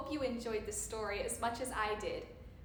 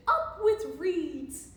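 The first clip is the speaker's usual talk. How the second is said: slow, loud chant, pitched up; mildly hammed-up rallying hoot; hooted cheer; 4 semitones higher, 8 dB louder, slower than usual. A narrator's reading. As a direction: hoot it out like a slogan. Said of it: "And they hooted".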